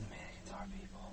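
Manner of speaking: hushed and voiceless; whisper. Real, not acted. Player repeating the creepy discovery to a friend, whispered. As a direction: whisper the eerie conclusion. Hushed remark in horror game.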